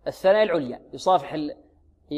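ordinary talk

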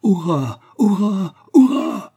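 climbing cries of victory; triumphant shouts building. A narrator's reading. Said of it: "de sa voix la plus éclatante et la plus mâle".

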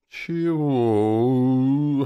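high, loud, long-held "what?"; drawn-out call. A narrator's reading. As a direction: call back loudly, stretching the vowel. Demon calls back to the narrator.

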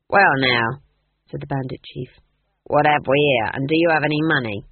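low, loud pirate swagger; a hint of a bragging bandit chief; gruff boastful bandit. A narrator's reading.

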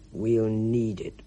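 very slow, sighing gloom; gloomy Mister Ackley. A narrator's reading. Mister Ackley answers Spud, very slow and gloomy. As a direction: let the pessimism ooze.

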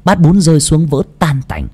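ordinary delivery, nothing marked

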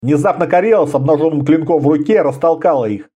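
ordinary delivery

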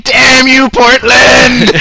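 high, loud dramatic curse; mock shout. Friend recalls cursing the city, loud hammed-up shout. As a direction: shake your fist at the sky.

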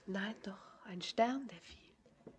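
bashful, breathy whisper fading to nothing; embarrassed whisper. Acted. Girl caught staring at the stranger, whispering shyly. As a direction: hide the words, almost ashamed to say them.

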